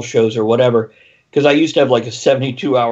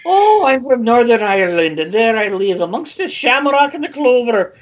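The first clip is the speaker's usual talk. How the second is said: high, hammed-up Irish lilt; deliberately horrible Northern Irish accent; 9 semitones higher than usual. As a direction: play a high-voiced stage Irish local. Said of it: "do a horrible one".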